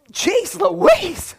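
high, loud mock shock; shocked exclamation. Real, not acted. Preacher reacts to the verse with high, loud mock shock. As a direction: gasp it out in disbelief. Shocked aside at the neck-breaking.

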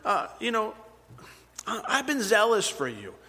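high, pleading whine; whining. Retold or imitated. Elijah whining to the Lord about his troubles, high and whiny. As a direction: whine like a hurt child who did everything right. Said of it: "kind of a little bit whining".

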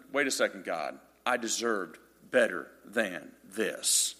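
slow, low, quiet grumble at God; self-pitying complaint. Retold or imitated. Tired father grumbling to God on the beach, sulky. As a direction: sulk to heaven like a tired dad.

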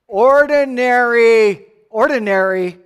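loud and slow, ordinary stretched out; proclaimed. Real, not acted. Preacher proclaiming ordinary to the congregation, loud and slow. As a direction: boom the word out to the room.

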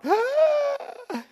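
loud, high drawn-out mock gasp; big theatrical gasp of horror; shocked gasp. Preacher acting out the wine running out, shocked gasp.

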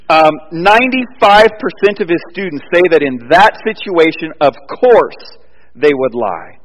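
loud, high preacher's build; preaching at full force. Acted. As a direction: drive the point home from the pulpit. Sermon rising to full force.

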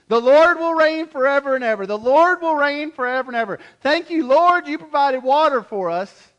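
loud, high exultant praise; joyful loud praise. Acted. Thirsty people praising the Lord for water, loud and joyful. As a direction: shout thanks to the Lord like a rescued crowd. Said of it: "perhaps they start singing again".

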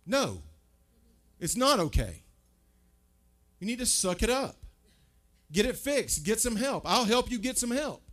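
preacher firmly refusing easy comfort; firm correction. Acted. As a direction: lay down the truth firmly. Firm truth after false comfort.

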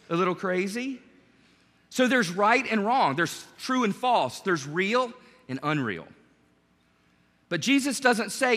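usual speaking voice

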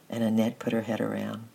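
ordinary delivery, nothing marked

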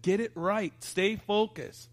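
mock yell, high and slow; retold scream at daughter. Father retelling yelling at his daughter, a mild mock yell. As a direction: snap the order out sharply but not at full volume. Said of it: "And I screamed at her".

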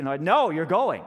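loud, slow insistent order; bossy overruling. Acted. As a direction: overrule the husband firmly. Wife overrules husband's refusal.

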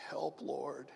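soft unvoiced "Help, Lord"; whispered prayer. Shown as a demonstration. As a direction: breathe the plea under your breath. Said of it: "It's a murmured prayer".

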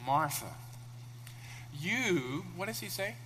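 slow, calm and gentle; calm Jesus. Acted. Preacher voicing Jesus calmly answering an upset Martha. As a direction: ease each word out, soothing. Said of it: "And Jesus calmly".

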